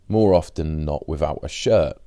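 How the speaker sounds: ordinary delivery, nothing marked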